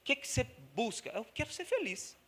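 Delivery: leve voz de entrevista de rua; entrevistado imitado. Retold or imitated. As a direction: pergunta e resposta rápidas. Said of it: "conversa com um por um". (entrevista de rua imitada de leve)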